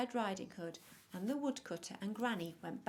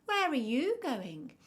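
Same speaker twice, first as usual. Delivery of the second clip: high, loud, drawn-out question; storybook wolf sizing up a child, slow and loud; wolf character; 6 semitones higher, 10 dB louder, slower than usual. A narrator's reading. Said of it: "said Mister Wolf".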